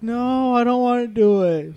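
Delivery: high, pleading no; whiny refusal. Retold or imitated. Waitress refusing the boss's cleanup order, high whiny plea. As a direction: beg your way out of the chore. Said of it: "she was like".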